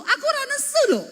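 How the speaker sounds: very high, loud protest; mock angry denial. Wife denying anger to her husband, mock angry denial. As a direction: deny anger while sounding furious, hammed up. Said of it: "nadamu tuh nesu loh".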